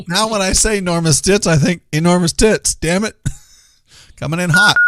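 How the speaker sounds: ordinary talk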